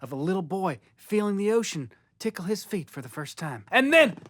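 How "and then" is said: dramatic boom. Acted. Hyped dramatic "boom" refrain.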